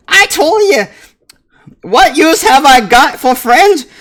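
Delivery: very high, loud dwarf brogue; indignant little girl squealing at her dad; hammed-up girlish squeak, loud; young dwarf girl. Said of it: "says the girl".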